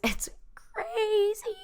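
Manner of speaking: very high, drawn-out, thin squeal; playful high squeal. Pretend. Host telling listeners about crazy times, in a playful high squeal. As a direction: stretch it out way up high, half-whispered.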